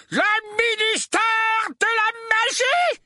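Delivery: slow, high bellow of outrage. A narrator's reading. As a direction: bellow it in furious disbelief. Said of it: "beugla l'oncle Vernon". (beuglement indigné)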